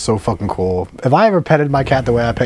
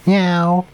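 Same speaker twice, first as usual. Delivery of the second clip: short, high cat meow; imitated meow; 8 semitones higher than usual. Shown as a demonstration. Host shows cohost what cats say, high meow. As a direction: mew like a cat, pitched up. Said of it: "They go".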